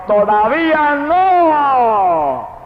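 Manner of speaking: high, drawn-out thundering call; God shouting through the night, stretched out high; booming voice of God. Retold or imitated. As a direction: boom it out very slowly like thunder from heaven. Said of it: "y la voz me gritó".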